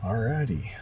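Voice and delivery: ordinary delivery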